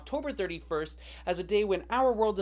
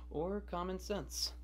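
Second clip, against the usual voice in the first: deadpan aside; 9 dB quieter, much slower than usual. Acted. Deadpan punchline.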